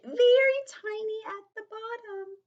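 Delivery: very high, squeaky little voice; shrunken, high-pitched narration; tiny voice. A narrator's reading. Voice mimics tiny squished letter.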